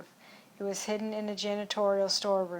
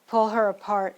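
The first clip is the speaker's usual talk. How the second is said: barked order, louder; loud command; 7 dB louder than usual. Acted. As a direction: order them to tear her apart. Leader orders her torn apart.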